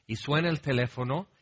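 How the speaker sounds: ordinary talk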